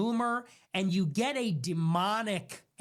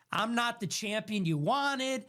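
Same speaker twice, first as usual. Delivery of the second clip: ordinary delivery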